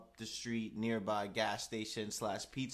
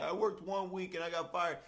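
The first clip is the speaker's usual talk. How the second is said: higher-pitched retold complaint; imitating aggrieved kid; 8 semitones higher, 3 dB louder than usual. Fired kid complaining about first week, imitated aggrieved. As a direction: whine the grievance as the fired kid.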